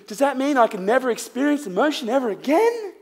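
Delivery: high, laughing mock panic; mock worried audience member. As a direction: parody the sceptic's alarmed question. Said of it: "some of you are thinking".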